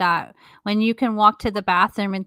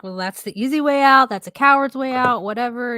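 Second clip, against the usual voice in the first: higher, louder mock of judgmental people; dismissive mimicry; 4 semitones higher, 5 dB louder than usual. Mimicking people who judge the mentally ill, dismissively. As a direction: voice the know-it-all critics, raised and pushy.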